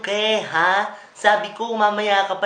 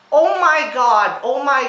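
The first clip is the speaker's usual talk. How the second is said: shocked outburst; 4 semitones higher, 10 dB louder, slower than usual. Acted. Wrong way discovered.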